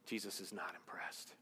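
hushed breathy whisper of the line. Acted. Preacher whispers to the congregation. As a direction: drop to a whisper to draw them in.

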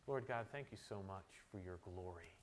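soft, low, reverent praying voice; prayer. Real, not acted. Pastor leading the congregation in closing prayer, hushed and reverent. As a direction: pray quietly with pauses between phrases.

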